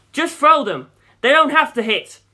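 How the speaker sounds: loud, high urgent yell; yelling back. A narrator's reading. Pilot yelling orders back at his navigator, urgent. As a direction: yell the order over the wind. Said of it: "I yelled back".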